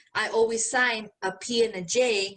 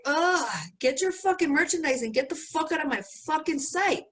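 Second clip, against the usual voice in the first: aggravated; 6 semitones higher than usual. Retold or imitated. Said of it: "I was so aggravated".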